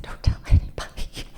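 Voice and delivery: hushed whisper; whispering the secret to a friend at the wedding. Retold or imitated. Bride whispering to a friend to keep her secret.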